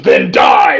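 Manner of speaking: loud, commanding villain shout; retold battle cry, full force. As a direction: bellow it as a sudden death sentence. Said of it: "she goes".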